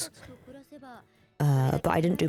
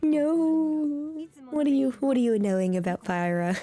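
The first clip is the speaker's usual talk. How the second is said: ordinary talk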